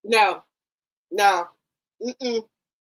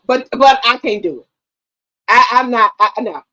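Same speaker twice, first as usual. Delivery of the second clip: ordinary talk, nothing marked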